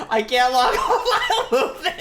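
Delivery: loud words bursting into laughter; helpless loud cackling complaint; laughing outburst. Real, not acted. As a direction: blurt it loudly through real laughing. Laughing at being stuck.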